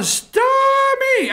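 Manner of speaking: high, stretched-out holler of a name; hammed-up high call to the stage; mock yell. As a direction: holler it like a besotted fan. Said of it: "And I was yelling".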